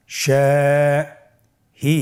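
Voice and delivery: Shahi split by a pause, first vowel held long; drawn-out syllable-by-syllable Shahi; broken into syllables. Shown as a demonstration. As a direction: break Shahi apart very slowly. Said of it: "ভেঙে ভেঙে পড়ব".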